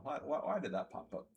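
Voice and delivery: usual speaking voice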